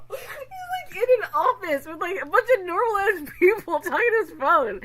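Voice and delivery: words squeezed out through laughter; laughing. Real, not acted. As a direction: keep laughing as you talk.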